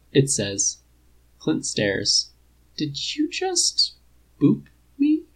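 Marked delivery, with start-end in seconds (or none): 2.8-5.2 very high, slow, disbelieving; incredulous. Acted. Clint asks the robot that poked his nose, high and stunned. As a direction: stretch out the disbelief, pitch way up.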